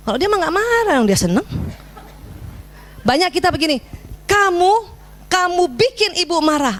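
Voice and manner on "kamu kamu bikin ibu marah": high-pitched, blaming scolding; mock accusatory telling-off; accusing. Shown as a demonstration. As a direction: throw the blame on the child, pitch raised. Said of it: "itu yang keliru".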